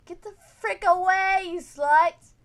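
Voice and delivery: enraged spirit screaming; furious spirit shout. Retold or imitated. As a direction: scream it like an angry ghost. Spirit's hostile message voiced.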